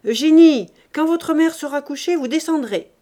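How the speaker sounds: loud, high call up the stairs; commanding father calling out; shouted summons. A narrator's reading. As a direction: shout the name, then give the order. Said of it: "il monta sept ou huit marches et cria".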